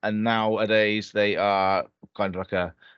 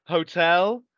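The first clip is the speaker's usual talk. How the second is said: put-on nervous, higher boyish answer; 6 semitones higher, 3 dB louder than usual. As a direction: sound like an intimidated kid. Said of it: "intimidated, like, boyish voice".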